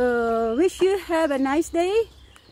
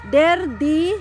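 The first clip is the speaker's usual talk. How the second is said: articles recited; 2 semitones higher, 5 dB louder, slower than usual. Shown as a demonstration. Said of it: "The article here is der".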